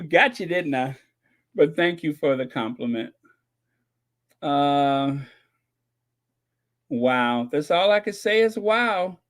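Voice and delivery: ordinary talk, nothing marked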